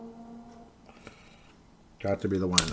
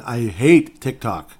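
louder repeat; 8 dB louder, much slower than usual. Real, not acted. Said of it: "Can I say that again?".

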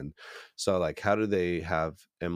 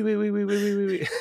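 high, loud hammy oui oui; mock French oui oui; 6 dB louder than usual. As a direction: squeal oui oui like a bad tourist. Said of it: "what a couple of idiot Americans sound like trying to speak French".

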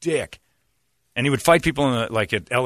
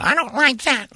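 very high hammed-up complaining voice; mock girly whine. Mocking girl who regrets playing, squeaky whine.

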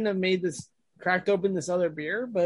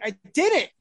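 indignant denial; 5 semitones higher than usual. Retold or imitated. Retelling own protest to supervisor.

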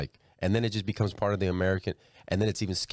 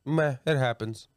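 mock dismissive, pitched up and drawn out; dismissive shrug; 5 semitones higher, slower than usual. Everybody's reaction to the scandal, mock dismissive. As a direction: shrug it off as everybody else would. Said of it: "everybody's like".

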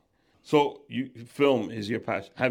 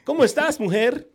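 much higher, louder Spanish dub voice; squeaky Spanish dub voice; 12 semitones higher, 8 dB louder than usual. Retold or imitated. Host mocking how Spanish dubs voice him. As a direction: squeak it out like a dubbing actor. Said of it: "always somebody with a different cadence and voice".

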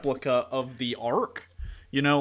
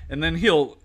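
ordinary delivery

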